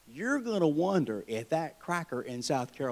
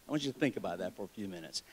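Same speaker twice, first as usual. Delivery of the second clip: ordinary delivery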